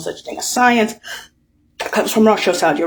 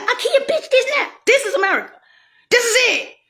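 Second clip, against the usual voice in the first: mock voice; 12 semitones higher than usual. Voicing the shit's reply.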